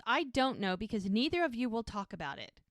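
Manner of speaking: ordinary talk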